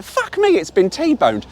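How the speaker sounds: high startled yelp of shock. Retold or imitated. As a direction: cry out as if the car was crashed into. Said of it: "I was like".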